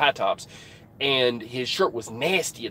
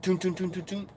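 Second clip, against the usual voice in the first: soft, higher imitated knocking; knock sound; 5 semitones higher, 8 dB quieter than usual. Trucker imitating a light knock on his door, soft mimicked knocking. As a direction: tap out a light knock with your voice. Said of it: "I hear a, a lighter".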